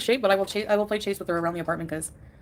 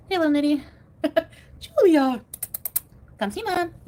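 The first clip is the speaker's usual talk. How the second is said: high, louder cooing at a pet; 8 semitones higher, 7 dB louder, slower than usual. Real, not acted. Owner greeting her cat, high cooing baby talk.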